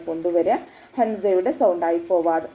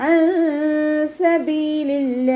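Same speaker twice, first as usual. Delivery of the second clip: slow, higher chanted സബീലില്ല with the nun hidden; correct recitation; 7 semitones higher, 4 dB louder, much slower than usual. Shown as a demonstration. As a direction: chant സബീലില്ല slowly, nasal and drawn out. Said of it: "എന്ന് ഓതരുത്".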